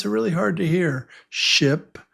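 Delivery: ordinary delivery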